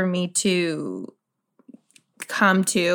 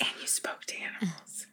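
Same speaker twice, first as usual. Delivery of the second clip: hushed whispered question; stage whisper; 3 semitones lower, 18 dB quieter, faster than usual. Real, not acted. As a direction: whisper it like a secret. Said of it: "these stage whispers are helping".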